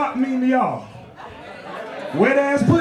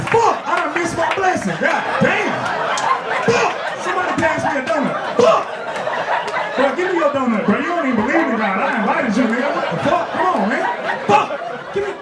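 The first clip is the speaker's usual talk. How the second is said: high-pitched frustrated pleading; comically exasperated, pitch jumping up; mock outrage; 3 semitones higher than usual. Churchgoer begging for a donut blessing, high mock outrage. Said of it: "I'm mad".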